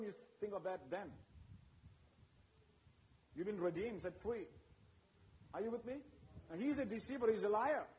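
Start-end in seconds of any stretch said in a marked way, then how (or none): none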